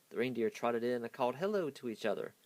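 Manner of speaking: ordinary delivery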